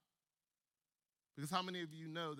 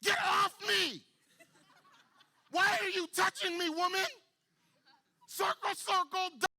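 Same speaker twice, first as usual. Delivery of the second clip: annoyed bystander; 12 semitones higher, 10 dB louder than usual. Retold or imitated. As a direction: shriek like a grossed-out kid. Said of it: "and people are going".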